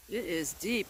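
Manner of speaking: ordinary talk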